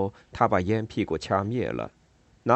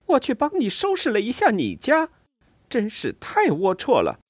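high, indignant complaint; indignant woman voice; 13 semitones higher, 4 dB louder than usual. A narrator's reading. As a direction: voice her high and scandalized. Ada's indignant reply.

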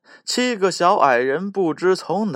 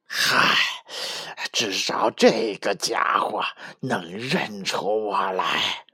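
nervous wolf; 5 semitones lower than usual. Acted. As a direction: murmur it with relief, a little uneasy. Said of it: "大野狼咽了咽口水".

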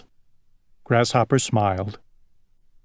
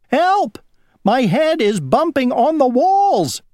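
high, wailing distress call; panicked cry; 13 semitones higher than usual. A narrator's reading. Worm tumbling downhill inside its apple, high panicked wail. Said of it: "cried the worm".

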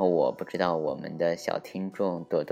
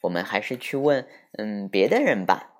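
put-on lively, higher storytelling voice for kids; children's story narration; 6 semitones higher, 4 dB louder than usual. A narrator's reading. Host reading a picture book to small listeners, animated storytelling. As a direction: read it like a bedtime tale full of voices.

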